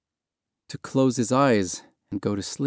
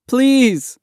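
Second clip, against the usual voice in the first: high, loud plea; desperate cry; 5 dB louder than usual. A narrator's reading. Boy clinging to the dinghy as hands pry him off, desperate cry. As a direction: cry out begging. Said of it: "Mahmoud cried".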